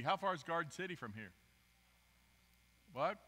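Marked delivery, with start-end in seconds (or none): none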